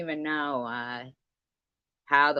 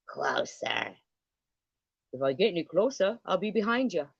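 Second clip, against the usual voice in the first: attempted Australian accent; 4 semitones higher than usual. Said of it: "I can't do an Australian accent".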